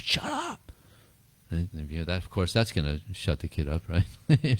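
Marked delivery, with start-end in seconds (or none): 0.0-0.7 high-pitched mock yell, kept soft; imitated shout. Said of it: "he's shouting at it".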